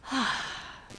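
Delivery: long, airy relieved exhale; soft releasing sigh; sigh of relief. Shown as a demonstration. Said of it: "Isn't that a relief?".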